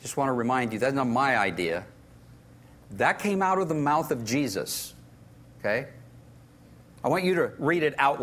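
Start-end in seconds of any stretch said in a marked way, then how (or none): none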